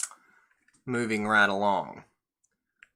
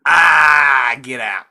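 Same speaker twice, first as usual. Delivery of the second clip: sudden, very loud mock scream; screamed outburst; 3 semitones higher, 16 dB louder, much slower than usual. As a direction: burst out screaming to drive someone away. Acted outburst in imagined book ending.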